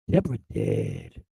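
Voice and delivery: deep hollow mock of a vacant old man; imitating dazed father. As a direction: sink Deborah dead way down into a slow hollow drone. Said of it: "he whirled and go like this".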